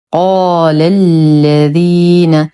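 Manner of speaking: slow chanted tajweed, vowels held; Quran recitation. Shown as a demonstration. Teacher models the phrase for learners, slow recitation. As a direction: recite with a heavy drawn-out qaf.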